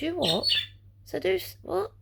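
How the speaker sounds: high, startled double take; shocked disbelief. Retold or imitated. Mum hearing what the partner said, high shocked disbelief. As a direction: jump up in pitch, caught off guard.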